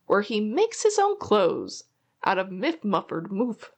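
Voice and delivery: usual speaking voice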